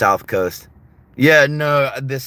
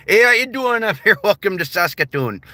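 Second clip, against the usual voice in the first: high, cheery far-north Canadian greeting; mock a friendly Saskatoon local's accent; hammed-up Canadian prairie accent; 8 semitones higher than usual. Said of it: "until you head way up north".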